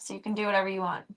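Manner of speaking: ordinary delivery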